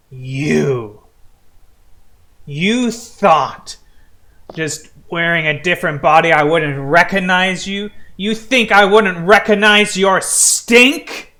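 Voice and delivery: indignant confrontation, rising to a loud peak; accusing Jaju. Acted. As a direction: start measured, build to outraged disbelief. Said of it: "Jaju turns around to your party, holds up a finger, and says".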